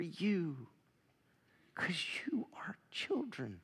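sudden drop to an unvoiced whisper; hushed whisper. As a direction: lean in and breathe it to them. Preacher drops to intimate hush.

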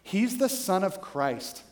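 slow, low-key deadpan imitation. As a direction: imitate someone solemnly sure of himself. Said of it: "he just looked deadpan in the camera".

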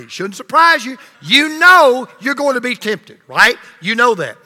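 ordinary talk

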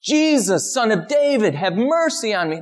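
high, pleading cry for mercy; crying out again. Retold or imitated. Beggar who won't stop crying to Jesus, high and pleading. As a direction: call out high, refusing to stop.

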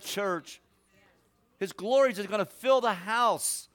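loud, high, ringing preacher's cry; full-force preaching. Acted. Preacher proclaiming a vision to the church, booming. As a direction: build the vision into a roar.

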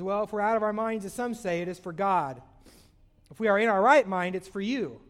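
loud, declaiming preacher voice; fervent scripture quote. Acted. Preacher quoting Paul to congregation, loud declaiming. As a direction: proclaim the verse to the hall.

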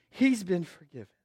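ordinary talk, nothing marked

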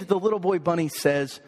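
usual speaking voice

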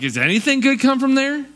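mock the hometown, pitch jumping up; scoffing disbelief. Preacher voicing a doubting disciple, incredulous scoff.

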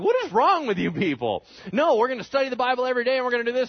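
high, loud, rushed exasperation; mock freak-out. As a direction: scold them in acted-out outrage. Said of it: "Well, he freaked out".

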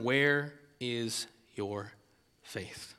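very slow, fading to a whisper on faith; Jesus asking his disciples, slow and hushed; soft rebuke. Pretend. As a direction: let the question sink in quietly.

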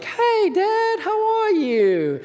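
sweet daughter. Retold or imitated. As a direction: sweet-talk Dad like a scheming daughter. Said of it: "literally ultimate sweetness and kindness".

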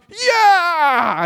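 high, loud cheer; cheering yell. Retold or imitated. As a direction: whoop like a crowd leader. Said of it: "rally cry".

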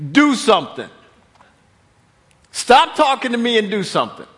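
stern command. Acted. God rebukes Moses.

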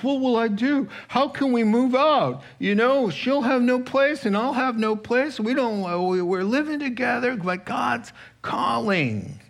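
high, pleading excuses; a reluctant follower begging off, high and whiny; whiny excuse-maker. Acted. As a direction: plead your way out, voice pitched high.

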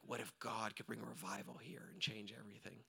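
low, half-whispered wondering; hushed hope. Acted. Believer daring to dream of revival, hushed hope. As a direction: breathe the bold question very softly.

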